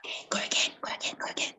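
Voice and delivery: hushed, quick whisper. Shown as a demonstration. Coach urging participant to go again, whispering. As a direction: whisper it urgently under your breath.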